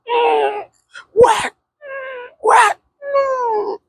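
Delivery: high, whimpering cries between swats; hammed-up crying at each swat; mock crying. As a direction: whine like a kid getting paddled. Him being paddled.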